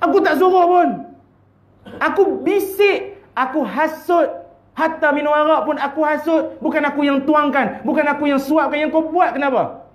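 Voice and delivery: high, sly devil denying blame; devil's retort. Acted. Devil mocking the sinner's excuses, high and sly. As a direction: wash his hands of it, mockingly.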